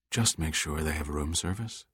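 low, soft and husky; a husky, intimate murmur. Acted. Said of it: "In a husky voice whose tone was unmistakable".

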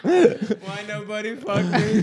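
high, slow mock wail; drawn-out high put-on accent; Chinese incel voice. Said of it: "the Chinese incel".

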